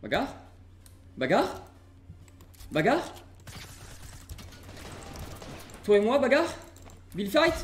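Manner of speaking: building mock taunt; taunting challenge. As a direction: goad the enemy into a fight. Taunting opponent in game.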